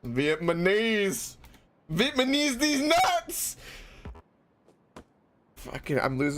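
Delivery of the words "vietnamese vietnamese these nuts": mock-dramatic high squeal, drawn out; goofy high-pitched cry of the pun; playful squeal. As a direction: yell the pun in a silly high voice. Joking wordplay shout.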